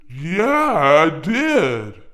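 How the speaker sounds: very slow, drawn-out yes; slow stubbled guy. Retold or imitated. Neighbour answering the narrator, slow unhurried drawl.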